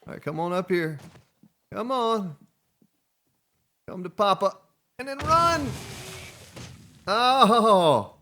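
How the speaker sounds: high, loud teasing call; high excited coaxing call; gleeful coaxing. Real, not acted. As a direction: egg it on gleefully. Coaxing the vehicle along.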